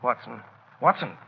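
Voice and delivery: normal talking voice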